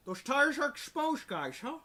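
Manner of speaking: bad Dutch accent sliding into Scottish, slushy s sounds; mock Dutch that lands in Scottish; Jonathan Pierce. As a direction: imitate a commentator's botched Dutch accent. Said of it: "now it just sounded like Sean Connery".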